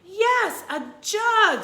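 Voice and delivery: high loud yes a jug; excited. Acted. Annie confirming the jug to the Ghost Queen, excited. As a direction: chime in thrilled.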